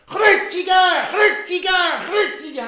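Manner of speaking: high, loud chanted demand; crowd shouting. Acted. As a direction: chant for the execution. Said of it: "haben sie gerufen".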